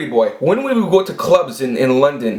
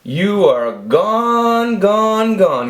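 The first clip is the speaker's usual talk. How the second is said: imitating the boss, very slow and higher; mock boss verdict; 7 semitones higher, much slower than usual. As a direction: draw out the firing line like a smug boss. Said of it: "He says".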